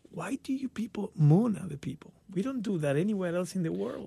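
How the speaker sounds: whispered, puzzled question about mooning; half-whispered, baffled foreigner's question; hushed question. Real, not acted. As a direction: lean in and ask it under your breath.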